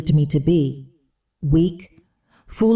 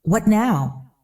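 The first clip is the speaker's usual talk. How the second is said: annoyed; 4 semitones higher than usual. A narrator's reading. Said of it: "more annoyed".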